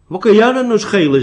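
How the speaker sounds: high, loud dismissive question; scoffing. A narrator's reading. Hermit belittles the young seeker's fears, scoffing loudly. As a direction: pounce on it with exaggerated disbelief.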